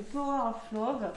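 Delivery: English words with a French accent; French speaker's English pronunciation. Real, not acted. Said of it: "mon accent anglais".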